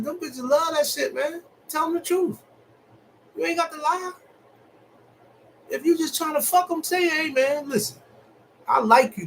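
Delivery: hyped speaker lecturing the guys on honesty; hyped-up rant. Acted. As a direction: fire it off like you're schooling the homies. Hyped advice rant to men.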